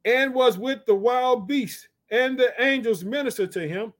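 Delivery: high, drawn-out verse reading; scripture declamation. Acted. Preacher reads of angels ministering to Jesus, declaiming.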